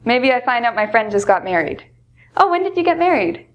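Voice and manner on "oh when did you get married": high, bright surprised question. Shown as a demonstration. Teacher acts out hearing a friend married, high surprise. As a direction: pitch it up in delight at the news. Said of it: "really surprised".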